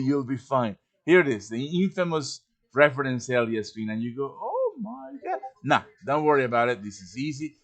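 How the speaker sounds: ordinary talk, nothing marked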